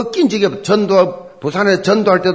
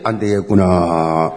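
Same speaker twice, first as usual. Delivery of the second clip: low, slow groan of giving up; 낙담한 응원단; 12 semitones lower, slower than usual. Retold or imitated. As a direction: imitate deflated fans, drawing it out low. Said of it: "힘 다 빠져가지고". (힘이 다 빠진 낮고 느린 탄식)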